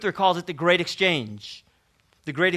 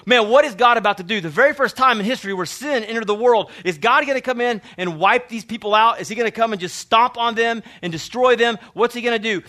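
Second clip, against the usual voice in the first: loud, higher, urgent questions; lettore col fiato sospeso; 5 semitones higher, 5 dB louder than usual. Acted. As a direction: voice a gripped reader's alarmed suspense. Imagined reader on edge of seat.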